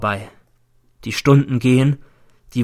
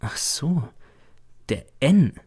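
ordinary delivery

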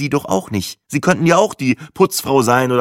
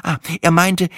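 plain reading voice

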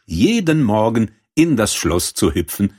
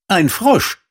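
high-pitched servant voice; servant's answer; 11 semitones higher, 3 dB louder than usual. A narrator's reading. Servant repeats the news to the princess, high-pitched answer. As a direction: answer up high, rising at the end.

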